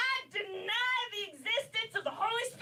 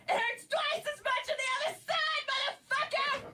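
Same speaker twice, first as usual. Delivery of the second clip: retold threat yelled higher; 6 semitones higher than usual. Shouting a threat at the attacker to end the story. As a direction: bark the punchline at him. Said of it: "I'm just like".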